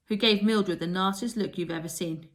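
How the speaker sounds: usual speaking voice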